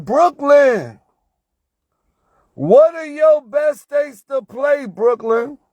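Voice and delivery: loud, high mocking repeat of viewers' question; mock yell. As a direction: shout it back at them like an exasperated parody.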